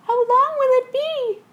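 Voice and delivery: fretful question; anxious passenger. Acted. Passenger asking the captain how long.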